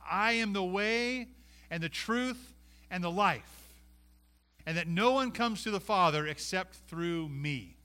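ringing reading of Jesus' words; scripture reading. Acted. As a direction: proclaim it to the congregation. Quotes Jesus, raised and slow.

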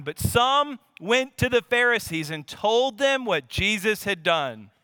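ordinary delivery

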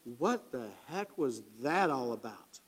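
a touch of lingering unease; puzzled inner thought. Retold or imitated. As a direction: muse to yourself, bewildered. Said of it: "for years I wondered".

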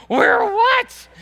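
very high, loud bewildered question; baffled outburst. Retold or imitated. Wife to fans who keep chanting, loud baffled outburst. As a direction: cry it out high, totally lost. Wife's reply after chant keeps going.

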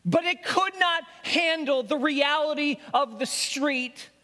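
high, pressing sermon voice, building; impassioned preaching. Acted. Preacher laying out his case to God, impassioned and high. Preaching resumes at full pitch.

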